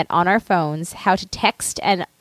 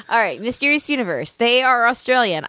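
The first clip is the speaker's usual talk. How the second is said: ordinary talk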